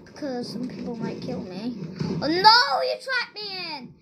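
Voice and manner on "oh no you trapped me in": panicked cry. Real, not acted. Trapped by player.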